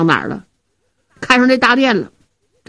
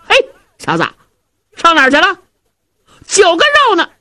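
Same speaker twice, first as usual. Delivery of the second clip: hungry hero yelling at his servant, higher and louder; impatient shout; 8 semitones higher, 3 dB louder, faster than usual. Acted. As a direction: bark it out, fists raised. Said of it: "一看他空着手回来就急了".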